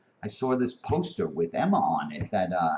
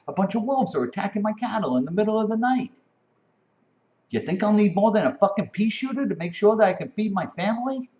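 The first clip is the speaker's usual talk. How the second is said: shouting rant; 9 semitones higher than usual. Real, not acted. Heated rant about rights.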